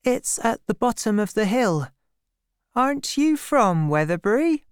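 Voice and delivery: put-on high-pitched, shy girl character; shy young girl. A narrator's reading. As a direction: ask shyly, pitched well up. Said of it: "she added shyly".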